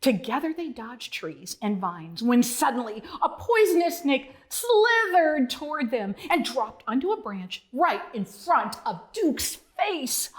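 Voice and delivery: ordinary delivery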